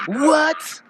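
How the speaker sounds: incredulous through laughter; amused disbelief; laughing what. Real, not acted. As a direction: ask it while laughing.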